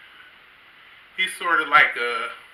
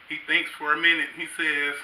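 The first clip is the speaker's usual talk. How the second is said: ordinary talk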